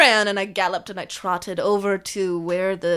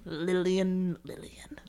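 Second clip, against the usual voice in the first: softer and softer, into a whisper; fading to a whisper; 4 semitones lower, 11 dB quieter, slower than usual. Acted. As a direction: let the name trail off into a whisper.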